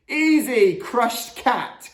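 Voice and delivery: high, loud eager shout; called out. A narrator's reading. Gamble answers dad's I-spy game, calling out. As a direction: blurt the answer out triumphantly. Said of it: "called out Gamble".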